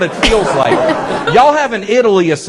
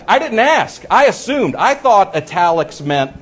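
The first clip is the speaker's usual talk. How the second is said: ordinary delivery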